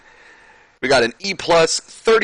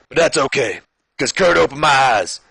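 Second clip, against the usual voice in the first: high, loud wrestler promo; hammed-up impression sounding like Stone Cold; Booker T; 4 semitones higher, 4 dB louder than usual. Champion answering talk of company fusion, loud put-on promo. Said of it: "This is still Stone Cold".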